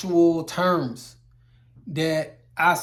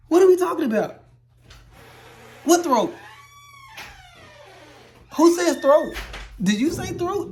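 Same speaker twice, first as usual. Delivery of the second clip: mock outrage; 11 semitones higher than usual. Welding teacher scoffs at the textbook term throat, high mock outrage. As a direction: act scandalised that anyone says it.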